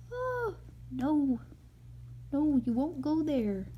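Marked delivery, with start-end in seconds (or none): 0.1-1.4 higher oh no, hammed up for a child; playful dismay; mock dismay. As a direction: act shocked for the listener. Mother reacts to sad story.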